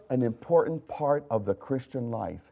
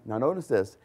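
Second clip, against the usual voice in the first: ordinary talk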